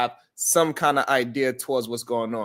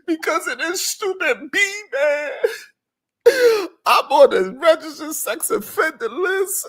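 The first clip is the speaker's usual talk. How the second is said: high, whining mock sob; mock whiny confession; 13 semitones higher, 5 dB louder than usual. Host imitates Joseph blaming a woman, high whiny mock cry. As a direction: overplay the victim. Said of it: "And then he went and said".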